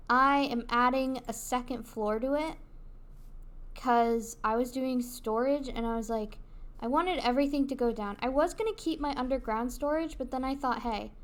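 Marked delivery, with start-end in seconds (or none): none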